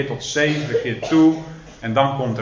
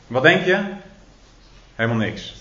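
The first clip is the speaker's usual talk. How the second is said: ordinary talk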